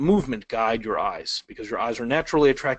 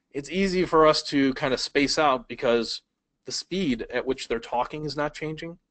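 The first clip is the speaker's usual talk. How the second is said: ordinary talk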